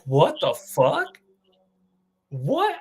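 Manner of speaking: can't believe your eyes, voice jumping up; stunned disbelief. Retold or imitated. Watching a surprise return on video, stunned and disbelieving. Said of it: "And I'm like".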